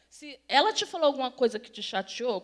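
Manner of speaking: ordinary delivery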